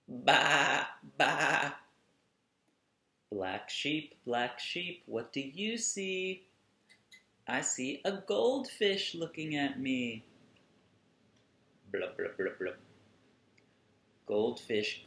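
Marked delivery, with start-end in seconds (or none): 0.1-1.7 loud bleating baa; sheep bleats. Pretend. Making the sheep's sound. 11.9-12.7 bubbly blub noises; fish blubs. Pretend. Host reading to kids, blubbing like a goldfish.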